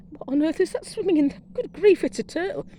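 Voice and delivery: startled exclamation told to viewers; retold astonishment. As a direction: relive the moment you spotted it. Retelling spotting the turtle.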